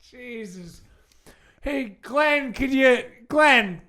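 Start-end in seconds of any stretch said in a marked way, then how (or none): 1.6-3.8 calling out nervously. Pretend. Calling to a screaming neighbor.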